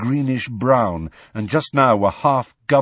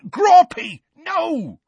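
alarmed, very high, loud cry; Hagrid yelling; 7 dB louder, much slower than usual. A narrator's reading. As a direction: cut in with a sudden shouted warning. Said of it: "they heard Hagrid yelling".

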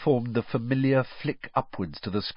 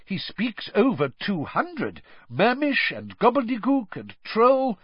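high-pitched, eager admiration; keen admirer showing off knowledge; writhing with excitement; 12 semitones higher than usual. A narrator's reading. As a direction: brag excitedly about your hero. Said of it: "positively writhing with excitement".

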